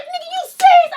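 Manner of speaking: high incredulous mock yell; mock angry outburst. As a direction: play the disbelief big. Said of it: "I'm like".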